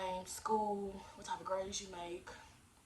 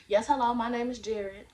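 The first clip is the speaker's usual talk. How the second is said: higher, louder polite phone voice; customer service voice; 4 semitones higher, 11 dB louder than usual. Shown as a demonstration. Teen applicant phoning a workplace, customer service voice. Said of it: "put your customer service voice on".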